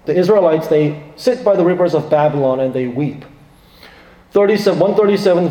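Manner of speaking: ordinary delivery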